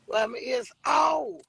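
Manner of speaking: sudden high cry of pain; startled by sharp ear pain; pained yelp. Real, not acted. Reacting to pain in ears.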